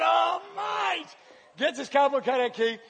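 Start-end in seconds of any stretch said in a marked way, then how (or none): 0.0-1.1 high, drawn-out groan of disbelief; mock dismay. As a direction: imitate appalled onlookers, stretching it upward. Onlookers' retold dismay.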